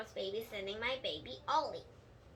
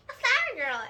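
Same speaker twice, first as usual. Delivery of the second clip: excited; 10 semitones higher, 8 dB louder than usual. Retold or imitated. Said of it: "Lucille is like".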